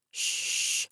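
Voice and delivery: long, voiceless "shh"; firm shush. Acted. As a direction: hush them mid-sentence. Shushes the narrator.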